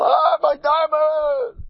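high, slow, drawn-out wail of loss; mock scream. Man wakes to find diamond gone, hammed-up anguished scream. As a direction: howl it like the diamond is gone for good. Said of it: "Scream, yell".

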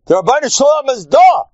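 high, loud cry of faith; emphatic proclamation. Real, not acted. Proclaims the answer to the audience.

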